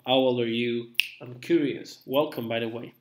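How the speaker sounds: normal talking voice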